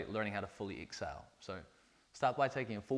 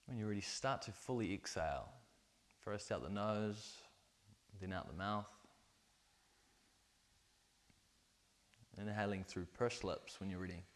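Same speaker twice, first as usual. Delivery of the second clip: soft guided breathwork voice; guided meditation; 5 dB quieter than usual. Acted. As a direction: lead the breathing gently.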